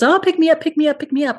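imitating a pleading toddler. Daughter begging mommy to pick her up, high pleading voice. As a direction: beg like a toddler reaching up for mommy.

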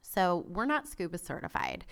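ordinary talk, nothing marked

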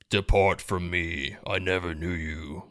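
slow, low, hushed menacing stage voice; scary voice. Retold or imitated. Imitating the church play's verse that frightened them, scary voice. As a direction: intone it slowly and ominously, like a church play. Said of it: "told in a very scary voice".

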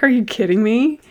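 lightly acted amused disbelief; incredulous. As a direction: retell your disbelief with a lift in pitch. Retelling own disbelief at the advice.

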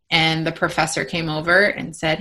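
normal talking voice

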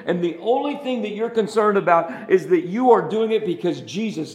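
loud, high, driving sermon voice; full-force preaching. Real, not acted. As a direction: preach it out with rising fire.

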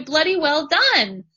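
slow, emphatic high praise; proud praise. Pretend. Coach shows how to congratulate your future self, slow and loud. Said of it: "and say".

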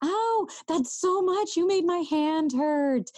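high, playful mock pain; mock hurt. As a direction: squeal in pretend pain. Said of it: "until I'm going".

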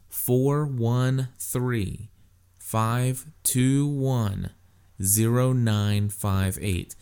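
each digit slow and separate; slow dictation. Real, not acted. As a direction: read the number slowly for listeners to write. Dictating the phone number.

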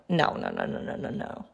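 softly whispered refusal, almost under the breath. Real, not acted. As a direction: drop to a breathy whisper on the repeats. Refusing to let it happen.

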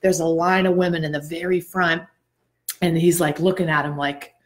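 ordinary delivery

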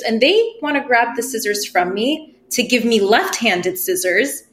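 ordinary talk, nothing marked

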